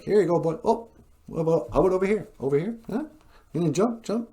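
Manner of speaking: high, playful pet-talk voice; light, high doggy talk; coaxing an animal. Retold or imitated. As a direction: coax a dog to jump for a treat. Acted man coaxing wolf to play.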